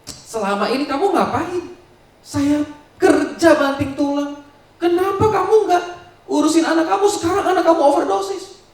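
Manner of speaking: high, loud, furious yelling; furious wife. Acted. Mother blaming husband for their daughter's overdose, screaming furiously. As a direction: scream the blame at him. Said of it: "Sumenep marah".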